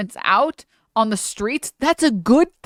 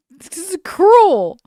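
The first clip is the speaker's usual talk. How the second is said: mock outrage; 8 semitones higher, 7 dB louder, slower than usual. Real, not acted. Climax of rant.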